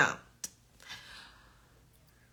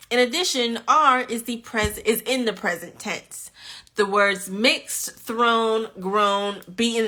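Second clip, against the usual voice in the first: ordinary talk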